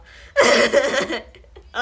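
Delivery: helpless burst of loud laughter; loud belly laugh. Real, not acted. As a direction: explode laughing at your own bit. Cracks up at own accent.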